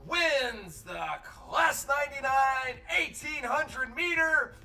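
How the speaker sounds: high, loud, stretched-out announcer shout; triumphant winner announcement. Acted. Announcer congratulating the winning horse, loud excited proclamation. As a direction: proclaim the result with drawn-out names.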